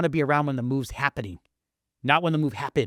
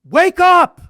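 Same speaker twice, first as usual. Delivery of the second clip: much higher, louder shout; yell; 13 dB louder than usual. Acted. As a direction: bellow it to rouse people. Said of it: "all I wanna yell is".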